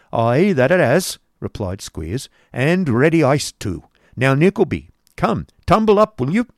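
loud, bossy schoolmaster; gruff Squeers. Acted. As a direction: order the boy up roughly. Squeers orders Nicholas up.

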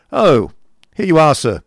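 high, loud curt greeting; brusque uncle. Acted. Uncle accosting his nephew in the yard, brusque and loud. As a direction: hail him loudly and sharply.

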